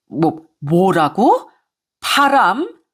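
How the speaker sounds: can't believe what she heard; shocked disbelief. Acted. Mother-in-law hearing of her son's affair, shocked.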